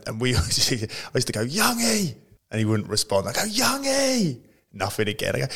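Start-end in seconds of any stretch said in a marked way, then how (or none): 1.4-2.2 high, drawn-out name call. Retold or imitated. Teammate calling to the keeper at the front, high call-out. 3.4-4.4 high, drawn-out name call. Retold or imitated. Teammate calling to the keeper at the front, high call-out. Calling again after no response.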